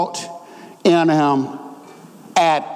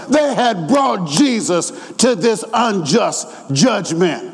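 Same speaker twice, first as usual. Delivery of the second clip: high, drawn-out pulpit proclamation; impassioned preaching; 7 semitones higher, slower than usual. Real, not acted. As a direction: preach it as a fervent defense, stretching key words. Preaches at full force.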